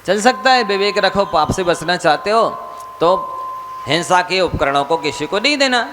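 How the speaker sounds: a preacher pressing his point; fervent preaching. Acted. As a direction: urge the congregation to give up sin. Monk exhorts listeners to renounce.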